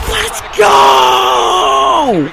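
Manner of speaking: stunned gasp into a roar; gasp then cheer. Real, not acted. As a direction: gasp and loud joyful yell. Last-minute goal.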